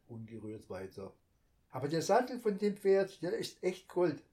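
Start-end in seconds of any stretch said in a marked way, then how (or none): 1.7-4.2 put-on higher, louder eager child voice; chattering little girl. A narrator's reading. Little girl babbles to her brother about her pony. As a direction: gush on about the toy pony.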